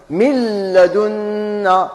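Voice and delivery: من لدنا said as "milladuna", nun fully merged, slow; drawn-out "milladunna" with the nun merged into the lam; correct merged reading. Shown as a demonstration. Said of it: "ما قلش من لدنا". (من لدنا تُقرأ ممدودة بإدغام كامل بلا غنة)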